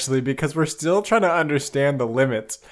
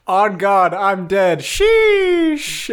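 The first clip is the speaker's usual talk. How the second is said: put-on much higher, loud, shouting young slang talker; hyped slang shout; 9 semitones higher, 9 dB louder, much slower than usual. Sparrow shouts slang at the confused shrews, loud and high. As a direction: yell it like a hyped teen.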